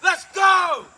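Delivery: loud and high, full of energy; hyped shout. Real, not acted. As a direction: shout it to pump up viewers. Hyped vlog intro.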